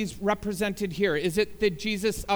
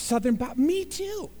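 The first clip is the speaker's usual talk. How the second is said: high, eager, delighted agreement; eager me too; 8 semitones higher than usual. Acted. Man on the bridge eagerly agrees with the jumper, high and delighted. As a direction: jump in happily like a new friend.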